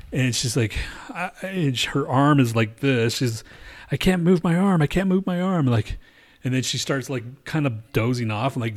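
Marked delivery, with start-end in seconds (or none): 3.9-5.6 higher-pitched mimicry of an injured girl; lightly acted panic; imitated distress. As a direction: voice her alarm, pitched up. Retold injured girl's panic.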